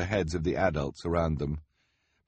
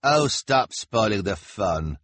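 louder, slow complaining husband; louder, slow put-upon husband; 4 semitones higher, 6 dB louder, slower than usual. A narrator's reading. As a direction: whine it out slowly. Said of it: "her husband said".

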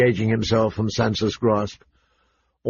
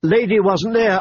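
put-on higher, louder angry girl; angry shout; 9 semitones higher, 3 dB louder than usual. A narrator's reading. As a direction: shout it back in anger. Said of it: "Arya shouted angrily".

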